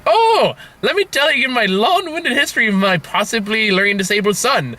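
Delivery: high, eager oversharing voice; gushing parent. Retold or imitated. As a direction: launch into it breathlessly. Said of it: "I'm an A B A therapist".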